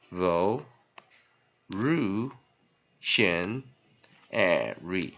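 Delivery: "ru, shen" as separate spaced syllables; syllable drill. Shown as a demonstration. Teacher drilling revolutionary, "ru, shen" sounded one by one. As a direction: isolate "ru, shen" and the syllables around them. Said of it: "再来一遍".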